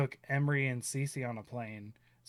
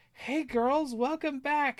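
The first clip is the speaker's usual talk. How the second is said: much higher, sing-song greeting; cheery waitress; 13 semitones higher, slower than usual. Retold or imitated. Dad imitating the waitress welcoming his girls, much higher. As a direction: chirp it like a friendly waitress.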